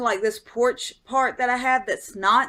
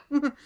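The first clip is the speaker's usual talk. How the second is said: short imitated cat meow; a brief little mew like a cat; 4 semitones higher than usual. Storyteller voicing the cat landing on all fours, short meow. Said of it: "and just goes".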